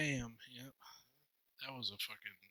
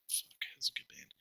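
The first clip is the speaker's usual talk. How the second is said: whispering; 10 dB quieter than usual. Real, not acted. Unvoiced aside.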